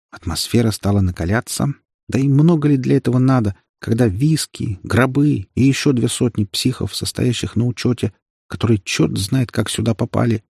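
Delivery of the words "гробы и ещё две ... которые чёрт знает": ordinary delivery, nothing marked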